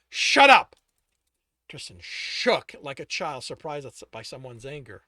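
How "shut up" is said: loud, high angry yell; furious yell at the pleading man; yelled shut up. Acted. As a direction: cut him off with a shout. Alex yelling.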